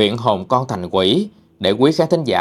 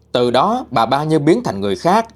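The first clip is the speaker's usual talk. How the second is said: usual speaking voice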